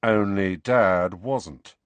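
slow, grim pronouncement; heavy, deliberate understatement. Acted. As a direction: land each word slowly and grimly. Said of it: "said Fred grimly".

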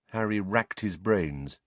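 usual speaking voice

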